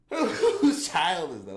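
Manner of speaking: slow, loud, laughing through the words; laughing disbelief. Real, not acted. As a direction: laugh it out in real disbelief, drawn out.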